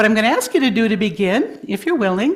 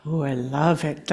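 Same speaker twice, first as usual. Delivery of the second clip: usual speaking voice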